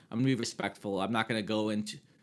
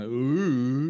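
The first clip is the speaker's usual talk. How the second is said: mocking ooh, higher and louder; mocking ghost ooh; 4 semitones higher, 7 dB louder than usual. Imitating a ghost that mocks someone. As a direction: tease like a ghost making fun. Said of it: "making fun of someone".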